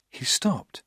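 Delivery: hushed, breathy narration whisper; soft suspenseful whisper. A narrator's reading. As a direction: drop to a whisper as he freezes. Tense pause in dark room.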